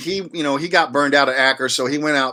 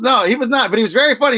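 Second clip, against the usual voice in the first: ordinary talk, nothing marked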